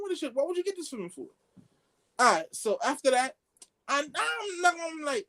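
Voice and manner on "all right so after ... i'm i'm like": ordinary delivery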